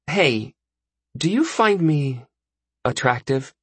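put-on high, hesitant girl's voice with pauses; tentative girl. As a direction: ask shyly, haltingly. Voices Gilly's message.

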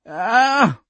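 high, loud whining "ah"; pained whine, pitched far up; whined cry. A narrator's reading. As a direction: whine out a sharp cry of hurt. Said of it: "Cap whined".

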